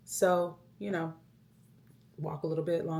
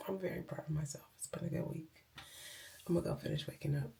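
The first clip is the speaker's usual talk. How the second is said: whispering; 2 semitones higher, 6 dB quieter, faster than usual. Real, not acted. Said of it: "I'm whispering".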